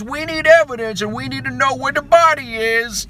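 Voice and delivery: high, loud hammed-up Italian-American mobster. Pastor explaining the resurrection to Kevin Sorbo, hammed-up Italian accent. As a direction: explain the resurrection like a goofy wiseguy. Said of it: "the way he's putting it is like".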